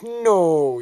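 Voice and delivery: high, loud drawn-out "no"; hammed-up refusal; mock protest. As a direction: cry out in playful objection. Acted battle protest.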